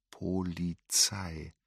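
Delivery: one drawn-out, slow, faint word; last effort of a dying breath; barely voiced. A narrator's reading. Dying grandmother mouths her final word, barely audible. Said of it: "formte ihr Mund noch".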